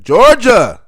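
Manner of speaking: Georgia called out high and loud; loud taunt at a rival team. Real, not acted. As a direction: call out the rival to mock them. Mocking rival teams.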